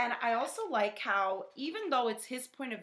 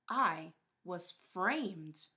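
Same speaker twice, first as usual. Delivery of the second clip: slow dramatic; 3 semitones lower, much slower than usual. Acted. Wolf's dramatic closing claim.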